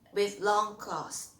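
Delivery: normal talking voice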